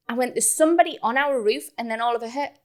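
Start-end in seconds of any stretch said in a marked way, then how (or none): 0.3-1.7 higher, acted insistence; urgent alarm. Woman telling partner of a roof intruder, urgent alarm. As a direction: repeat the warning with urgency.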